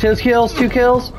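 high-pitched excited kill call; combat callout. Real, not acted. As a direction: call the kills out eagerly. Calling kills during firefight.

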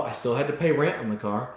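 usual speaking voice

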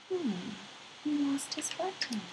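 hushed whisper, almost no voice; whispered aside. A narrator's reading. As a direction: lean in and whisper it like a secret. Narrator echoes softly to child.

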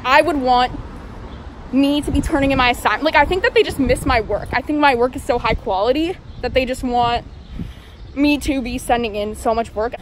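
muffled behind surgical mask; speaking while walking masked; through a face mask. Real, not acted. Seen 3: Surgical mask covers mouth and nose throughout; reference frames unmasked.

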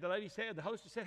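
usual speaking voice

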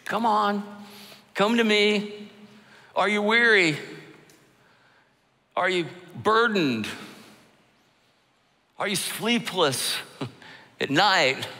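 a hint of Jesus calling out gently; inviting Jesus. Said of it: "Jesus offers you the invitation".

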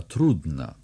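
ordinary delivery, nothing marked

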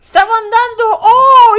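high, loud crying wail; dramatic wailing. Acted. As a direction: wail it like a tragic heroine. Dramatic rereading of line.

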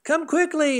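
put-on high, childlike call for mom; a little boy shouting for his mother, high-pitched. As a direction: call upstairs like a little kid. Boy calling for mom in story.

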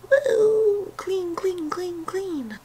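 high, soft singsong; tiny high-pitched playful voice; playful squeal. Pretend. Playful aside about showering.

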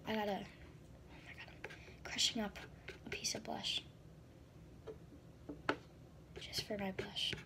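whispered running commentary; hushed, focused whisper. Real, not acted. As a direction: whisper through the fix. Whispers while fixing broken blush.

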